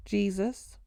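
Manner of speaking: ordinary delivery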